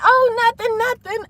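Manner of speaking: high, stammering mock innocence; playing it off. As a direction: brush it off in a small high voice. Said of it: "trying to play it off".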